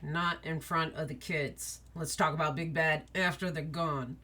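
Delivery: put-on older brother quietly putting off the talk; quiet warning look. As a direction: hush it so the kids can't hear. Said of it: "this look ... is like".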